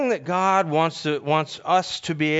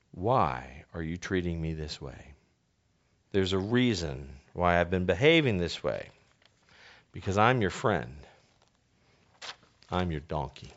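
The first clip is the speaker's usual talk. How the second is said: low, soft, hurt plea; gentle donkey; 7 semitones lower, 5 dB quieter than usual. Acted. Donkey finally speaks softly to Balaam, low and hushed. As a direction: quietly tell your friend why. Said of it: "the donkey opens his mouth and says".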